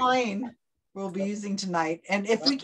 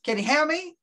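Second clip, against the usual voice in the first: put-on Boston accent; 7 semitones higher than usual. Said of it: "How do you say it in Boston?".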